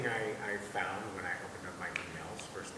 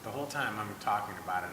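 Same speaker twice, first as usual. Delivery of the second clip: ordinary talk, nothing marked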